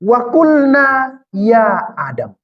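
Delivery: Wa qulna ya Adam dilantunkan pelan dan agak tinggi; bacaan ayat pelan. Shown as a demonstration. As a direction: lantunkan ayat seruan kepada Adam dengan khidmat. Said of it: "ayat itu". (ustadz membacakan ayat kepada jamaah, pelan dan khidmat)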